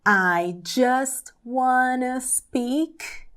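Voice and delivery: very slow, carefully spaced English lines for learners; slow repeat-after-me drill. Shown as a demonstration. Teacher drilling song lyrics for students to repeat, slow and deliberate. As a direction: model each line slowly so students can copy it.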